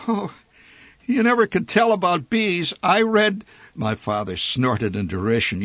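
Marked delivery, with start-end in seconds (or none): none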